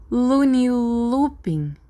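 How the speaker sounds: drawn-out, high mocking taunt; sneering taunt. A narrator's reading. Bart taunting Remus with a nickname, slow high sneer. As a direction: stretch the nickname out to mock him slowly. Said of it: "Bart sorriu".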